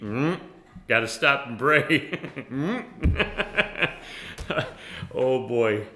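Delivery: loud amused laugh through the words; laughing aloud. Real, not acted. Preacher to congregation, laughing loudly at stopping to pray. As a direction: crack up at the idea.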